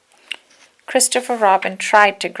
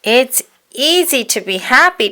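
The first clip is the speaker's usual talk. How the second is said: higher, louder bouncy cry; 5 semitones higher, 7 dB louder than usual. Acted. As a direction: sell it with gusto. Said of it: "Tigger cried".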